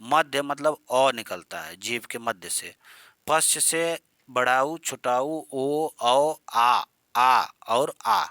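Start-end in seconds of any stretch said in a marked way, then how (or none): 3.3-8.2 slow, louder drawn-out back vowels; back vowel demo. Shown as a demonstration. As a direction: stretch each vowel slowly for the class. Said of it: "इतने निकलते हैं".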